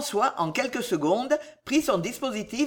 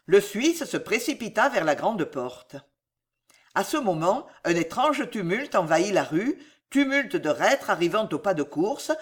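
ordinary delivery, nothing marked

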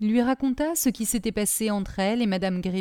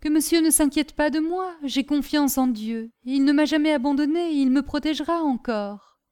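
lightly voiced maid, higher-pitched and confident; trusting servant Caroline; 7 semitones higher than usual. Servant tells master she trusts in God, earnestly.

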